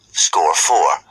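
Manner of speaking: plain reading voice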